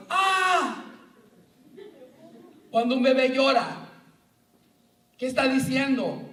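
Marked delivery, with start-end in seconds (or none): 0.1-0.8 high sudden yelp of pain; cry of pain. Retold or imitated. Preacher demonstrating the cry after hitting a finger, pained yelp. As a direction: yelp like you hit your thumb. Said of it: "Grito, ¿verdad?".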